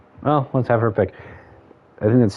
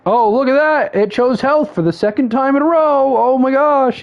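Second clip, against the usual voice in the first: high-pitched, put-on gasp of disbelief; mock astonishment; 14 semitones higher, 3 dB louder than usual. Streamer imitating himself cheating at the random pick, in high mock surprise. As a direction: fake being amazed, pitched way up. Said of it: "just so I'm not like".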